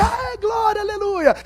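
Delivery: very high excited cry; mock church rejoicing. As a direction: cheer like a thrilled congregation.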